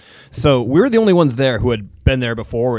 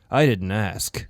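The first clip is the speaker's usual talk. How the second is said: contemptuous; 4 semitones lower, 6 dB quieter, slower than usual. Retold or imitated. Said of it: "looks at her with contempt".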